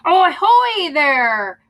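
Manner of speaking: high, loud, slow hail from above; calling out. Acted. Pirate hailing the captain from the tree, loud. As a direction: call down from the tree. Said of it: "called a voice".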